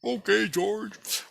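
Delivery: the Christ calling from the moon, high and slow; the Christ calling back. Retold or imitated. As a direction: stretch it out slowly, high and far away. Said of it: "He's like".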